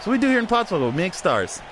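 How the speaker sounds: ordinary delivery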